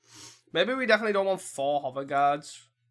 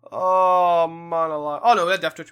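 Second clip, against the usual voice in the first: loud groan of dismay; 9 dB louder than usual. Real, not acted. Realising a misplay.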